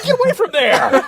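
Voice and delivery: laughing squeaky protest; high mock outrage; high mock yell. Angry victim reacting.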